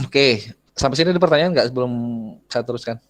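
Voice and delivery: ordinary talk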